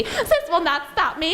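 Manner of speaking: high-pitched villain protest; acted high defiance; defiant villain. Villain defiant as she is defeated.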